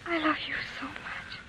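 breathless unvoiced whisper; whispered love. Daughter whispering love to her father, hushed whisper. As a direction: whisper it tenderly to Papa. Child tells father she loves him.

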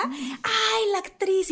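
lightly voiced starstruck lady; gushing mom. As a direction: fawn over the actress. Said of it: "se acerca".

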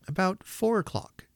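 put-on high old man's answer; Father Christmas. A narrator's reading. Old man telling children when he returns, high-pitched. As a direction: pitch it well up, good-humored. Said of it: "said he in a high-pitched voice".

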